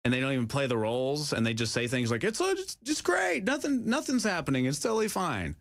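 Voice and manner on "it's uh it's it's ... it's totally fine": squeaky high fake-cheerful; mock denial. Mocking officials' scripted denial, high squeaky cheer. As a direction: pitch way up, chirp that all is fine.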